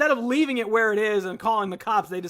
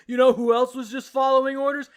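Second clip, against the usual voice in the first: raised, emphatic comeback; imitated indignant retort; 5 semitones higher, 6 dB louder than usual. March snaps back at the cop following orders, loud. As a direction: throw the line back loudly.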